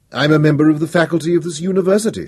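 loudly. A narrator's reading. Professor stating his standing to the speaker, loudly. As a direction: answer back with a carrying voice. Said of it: "equally loudly".

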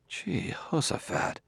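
slow, hushed exclamation of shock; stunned whisper. A narrator's reading. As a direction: whisper the oath, drawing it out. Bailey realizes he nearly drowned.

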